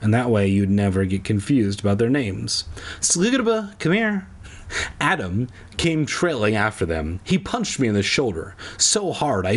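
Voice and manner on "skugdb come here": high-pitched mock parent call; put-on high summons; calling a child. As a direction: call the silly name in a high voice. Acts out calling a twin by name.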